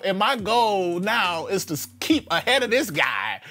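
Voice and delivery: ordinary talk, nothing marked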